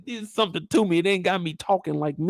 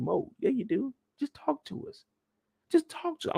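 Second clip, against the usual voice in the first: mock coaxing; 10 semitones higher, 7 dB quieter than usual. Mimicking cajoling investigators.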